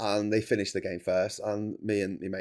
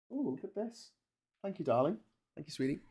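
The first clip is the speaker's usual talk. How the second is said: higher and softer, pleased thank-you; delighted thanks; 6 semitones higher, 8 dB quieter than usual. Real, not acted. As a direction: coo your thanks at the food arriving. Thanks someone bringing him food.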